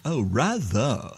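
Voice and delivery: drawn-out posh British "rather"; slow, hammed-up posh British voice; mock posh British. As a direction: put on a pinky-up posh British accent. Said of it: "does she talk with a pinky up".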